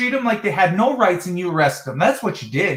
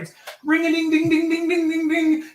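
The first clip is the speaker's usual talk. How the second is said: high sing-song ring-a-ding, much higher; imitated ringing; 9 semitones higher than usual. Caller mimics the dispatch phones ringing, high sing-song. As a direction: mimic phones ringing nonstop like a slot machine.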